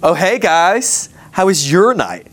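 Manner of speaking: acted smug, swaggering nonchalance. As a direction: stretch out the hello, casually pleased with yourself. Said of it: "they have a swagger".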